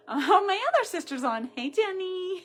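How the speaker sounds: high, loud, laughing hello; light up as her sister joins; excited greeting. Real, not acted. Greeting sister joining live.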